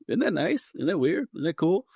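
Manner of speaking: high, sing-song wonder at the coincidence; pleasantly surprised. Retold or imitated. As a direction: marvel at it, pitch lifted high. Retelling his own thought on the plane.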